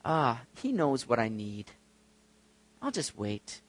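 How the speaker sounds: soft, acted giving up; passive resignation. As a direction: sigh it off and settle for waiting. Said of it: "How many of us think that way".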